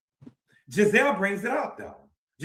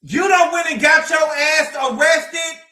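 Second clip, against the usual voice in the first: slow, loud calling-out shout; loud rant; 7 semitones higher, 7 dB louder, slower than usual. Real, not acted. Ranting at Wendy on camera, a slow loud shout. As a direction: yell it at her, slow and heavy.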